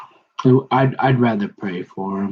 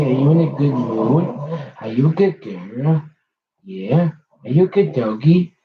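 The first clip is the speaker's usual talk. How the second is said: talking to a dog. Real, not acted. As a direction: coo praise at your dog. Cooing to her dog.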